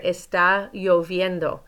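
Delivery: "Está lloviendo" slowly, the double L as a Y; slow model, Y sound. Shown as a demonstration. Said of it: "Lloviendo is a little bit tricky".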